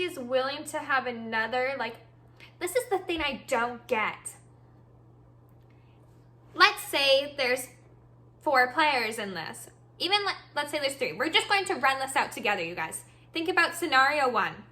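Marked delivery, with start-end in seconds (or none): none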